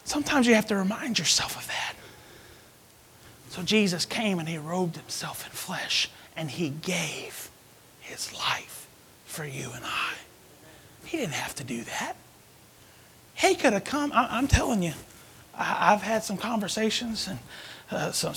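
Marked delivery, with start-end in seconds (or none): 3.5-12.1 slow hushed whisper, much softer. Real, not acted. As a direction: lean in and whisper it. Preacher drops to hushed whisper.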